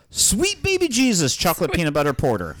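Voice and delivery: high, loud excited outburst; mock scream. Shown as a demonstration. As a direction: scream it out like a game buzzer. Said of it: "just scream out".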